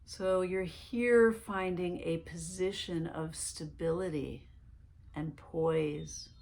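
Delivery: calm meditation guide voice; guided meditation. Acted. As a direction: lead them gently into relaxation, one breath at a time.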